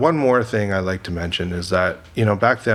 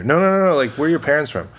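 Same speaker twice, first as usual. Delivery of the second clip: insistent casting director; 6 semitones higher, 3 dB louder, faster than usual. Retold or imitated.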